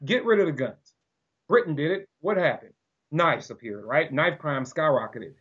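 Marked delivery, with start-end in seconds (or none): none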